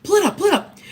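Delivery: high, hurried imitation of Peter; hammed-up eager friend at the phone; eager urging. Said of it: "He's like, he's like".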